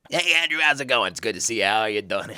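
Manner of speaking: mechanic impression, put-on smoker's voice; Brad. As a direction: greet like an old smoker mechanic, pitch sinking as it goes. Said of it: "do my best Brad impression".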